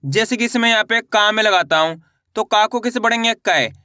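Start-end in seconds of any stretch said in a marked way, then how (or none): 3.3-3.7 "कै" as one syllable with ai, falling low; ai-matra demonstration. Shown as a demonstration. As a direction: model "कै" for learners, sliding down in pitch. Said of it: "आई करके उच्चारण निकालना है". ("कै" का स्पष्ट उच्चारण, गिरते सुर में)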